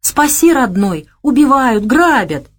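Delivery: mock the rivals begging for help; mock pleading. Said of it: "ко мне на цыпочках прибегут". (насмешливая мольба)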